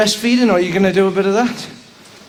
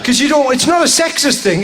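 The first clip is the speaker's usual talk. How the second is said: ordinary talk, nothing marked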